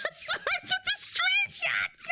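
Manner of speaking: very high, slow squeaky mimicry; hammed-up pitiful apology through laughter; mock apology. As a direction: squeak it slowly, half laughing. Voicing apologetic viewer's message.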